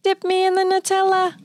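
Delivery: high little cookie character on one held note; voice of the Teddy Graham. Acted. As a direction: play the snack pleading in a high monotone. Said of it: "He's like".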